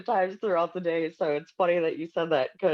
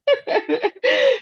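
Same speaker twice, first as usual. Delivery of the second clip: loud squealing laugh; high burst of laughter; 6 dB louder than usual. Real, not acted. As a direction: let out a big high laugh. Amused by the story.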